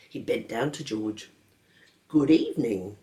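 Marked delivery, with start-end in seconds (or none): none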